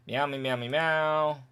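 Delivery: meowing gibberish in place of the dialogue; mock cat-people chatter. Skipping cat people's dialogue, mock meowing. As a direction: play the cat folk with light meows. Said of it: "Now we have the cat people".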